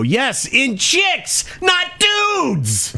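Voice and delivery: high, slow mocking voice; sarcastic. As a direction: sneer the correction slowly.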